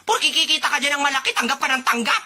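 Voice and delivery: high-pitched indignant complaint; indignant scolding. Acted. Customer scolding vendor for not listening.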